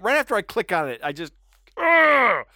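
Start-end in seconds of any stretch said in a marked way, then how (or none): 1.8-2.4 high loud disgusted ugh; frustrated groan. Real, not acted. Groaning at clicking clickbait.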